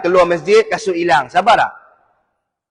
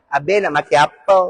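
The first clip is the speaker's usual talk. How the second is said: tomyam waitress; 3 semitones lower than usual. Acted. Waitress at tomyam shop asks a male customer, sweetly coaxing. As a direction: take the order in a coaxing lilt. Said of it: "lelaki suka pergi kedai toyam".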